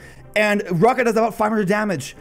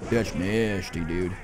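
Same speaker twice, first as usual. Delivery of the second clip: low grumble; 14 semitones lower, 7 dB quieter, slower than usual. Real, not acted. Reacts to enemy poison combo.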